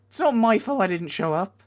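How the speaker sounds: high, loud complaint at unfair blame; indignant protest. Real, not acted. Player blamed for not showing up, indignant protest. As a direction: plead your case loudly, aggrieved.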